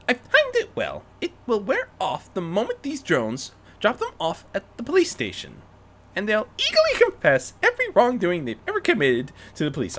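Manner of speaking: lightly voiced Rarity. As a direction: play her pleased with herself, lightly.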